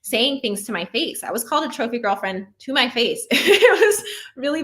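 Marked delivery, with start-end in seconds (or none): 3.3-4.6 laughing. Real, not acted. Laughing at being called trophy.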